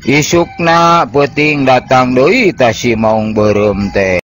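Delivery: ordinary delivery, nothing marked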